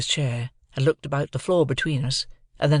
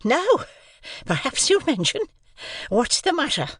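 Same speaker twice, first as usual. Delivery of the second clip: higher, breathless sister; mildly breathless sister; out of breath; 13 semitones higher than usual. A narrator's reading. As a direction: demand it while short of breath. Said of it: "out of breath".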